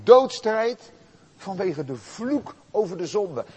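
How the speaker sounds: loud, urgent sermon build; impassioned preaching. Acted. Preacher describing Jesus' inner agony, impassioned and loud. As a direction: press the agony home with force.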